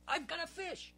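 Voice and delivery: excited yell. Acted. Boy at the creek yelling as his line goes tight. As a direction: yelp it as the fish bites. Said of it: "let out two yells".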